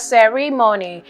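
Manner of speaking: CEREMONY with stress moved to the second syllable; mispronounced stress. Shown as a demonstration. Spelling teacher showing a common mistake, CEREMONY with misplaced stress. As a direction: say CEREMONY as ce-REE-mony. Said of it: "It is commonly mispronounced as".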